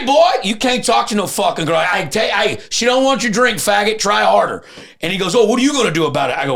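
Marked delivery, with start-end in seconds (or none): 0.0-4.6 loud, high shouting at a man at the bar; retold shout. Retold yelling at abuser. 5.3-6.4 fast, loud, high taunt; taunting challenge. Retold or imitated. Abuser taunts back.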